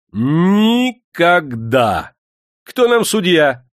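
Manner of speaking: громко и высоко, горделивый вызов; медленно, властно, свысока; вызывающе. A narrator's reading. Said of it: "сказал он вызывающе". (заводчик горделиво бросает вызов, громко)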